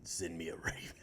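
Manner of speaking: very low, hushed gruff voice; gruff hero. Acted. Mock brooding hero giving orders, low and gruff. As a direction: growl it under the breath. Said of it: "heroes with the gruff voice".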